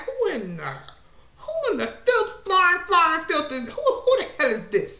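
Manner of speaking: high, cracking outburst of disbelief turning into a laugh; shocked disgust. Retold or imitated. Woman seeing her catfish walk down the stairs, shrieking in disbelief. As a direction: squeal it out like you can't believe who just walked in. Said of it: "her face was like".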